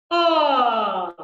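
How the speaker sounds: loud, long "ah" sliding down; low-breath vowel. Shown as a demonstration. Coach showing choir a low breath, open and loud. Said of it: "a low breath".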